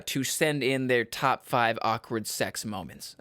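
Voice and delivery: ordinary talk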